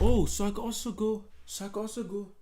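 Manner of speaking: excited realisation. Real, not acted. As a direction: jump in with a bright idea. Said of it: "excited idea".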